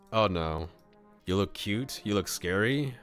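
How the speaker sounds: plain reading voice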